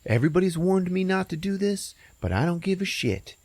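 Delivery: high-pitched defiant stoner; high, reckless stoner bravado; stoner guy. Retold or imitated. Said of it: "the guy's like".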